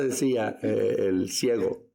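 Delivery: ordinary delivery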